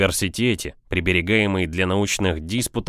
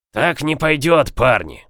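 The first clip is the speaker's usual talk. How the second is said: raised, displeased objection to the crew; disgruntled growl; 6 semitones higher, 4 dB louder, slower than usual. A narrator's reading. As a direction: growl it out in annoyance. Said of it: "недовольно прорычал Стур".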